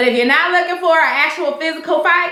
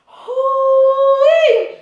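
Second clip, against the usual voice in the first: high, loud whoop after laughing; whooping exhale; 9 semitones higher, 3 dB louder than usual. Real, not acted. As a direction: let out a breathless whoop. Recovering from laughter.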